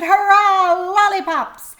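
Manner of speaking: high, loud cheer, drawn out; excited crocodiles shouting hurrah; chorus shout. Acted. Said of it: "A whole chorus of voices shouted".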